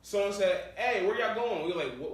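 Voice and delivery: ordinary talk, nothing marked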